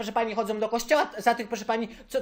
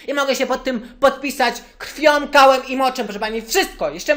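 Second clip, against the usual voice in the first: louder and higher, getting worked up; 5 semitones higher, 7 dB louder than usual. Acted. Caller swears to the lady, getting louder and higher. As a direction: swear it louder and higher, losing his temper. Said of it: "Zdenerwowałem się troszkę".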